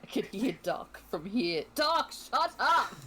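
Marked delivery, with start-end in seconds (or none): 1.7-2.9 high, loud, drawn-out scolding; slow, shouted telling-off; exasperated yell. Acted. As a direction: yell at a noisy companion to hush. Hushing a noisy party member.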